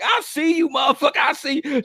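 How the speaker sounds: high, playful call across traffic; mock the lady calling him out from her window; high-pitched shouted tease; teasing call-out. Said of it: "She's like".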